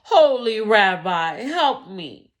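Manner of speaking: loud, slow desperate cry for help; begging the rabbi at full voice; desperate plea. Acted.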